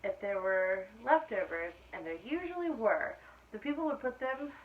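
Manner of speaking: clear, lively storybook reading; reading to children. A narrator's reading. Teacher reading aloud to her class, storybook voice. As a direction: read it out to the class like story time. Reads picture book to class.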